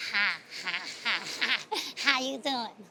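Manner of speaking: low, hollow cackling greeting; eerie neighbour's low chuckling hello; crazy Miss Stark. Retold or imitated. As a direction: play the odd neighbour laughing at you.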